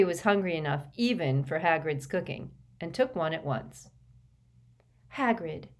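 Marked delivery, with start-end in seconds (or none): none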